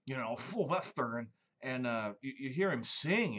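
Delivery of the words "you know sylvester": louder, lisping gravelly cartoon cat; spray the lisp like the cat; Sylvester voice. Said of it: "you got Sylvester".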